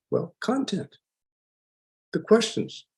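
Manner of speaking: ordinary talk, nothing marked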